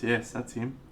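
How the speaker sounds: normal talking voice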